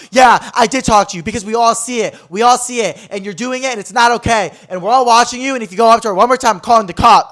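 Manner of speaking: high, forceful shouted telling-off; loud public warning, imitating an indignant man; retold yelling. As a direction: yell it from across the room. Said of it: "that guy was just yelling at me".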